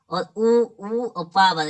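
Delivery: उ drawn out slowly with rounded lips; teacher demonstrating the lip vowel उ; sounding out lip sounds. Shown as a demonstration. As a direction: slowly model उ for students. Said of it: "ये बोला जाता है होंठ से".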